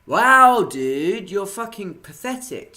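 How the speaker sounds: loud, high mocking disbelief; scornful outburst. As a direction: explode on "Wow", then pour on the contempt. Scornful retort to the preacher.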